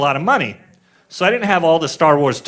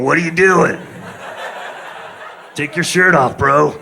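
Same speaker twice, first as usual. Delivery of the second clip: lightly voiced heckler outside a window; mock call-out from outside the window; guy at the window. Guy outside the window calling in, mock shout. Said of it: "Like the guy outside your window going".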